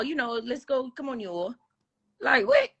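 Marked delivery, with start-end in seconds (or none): 0.3-1.5 "you all" in two full words; mock proper "you all". Mocking the others' way of talking, full "you all". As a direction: mimic them saying "you all" unmerged. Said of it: "Y'all say, "You all".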